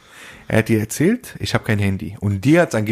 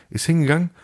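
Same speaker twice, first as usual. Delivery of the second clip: ordinary talk, nothing marked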